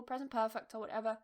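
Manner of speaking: ordinary talk, nothing marked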